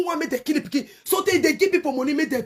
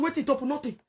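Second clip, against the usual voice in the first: ordinary delivery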